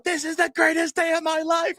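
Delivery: put-on high, shrieking kid's joyful shout; excited child yelling. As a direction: yell it like a thrilled little girl on a slide. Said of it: "and she's yelling".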